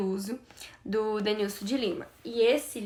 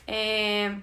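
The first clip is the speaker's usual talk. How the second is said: ordinary talk, nothing marked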